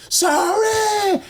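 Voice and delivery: very high, drawn-out squealed sorry; mock scream. Pinned bully forced to scream sorry, screaming. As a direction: imitate a beaten man screaming his apology. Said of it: "He starts to scream".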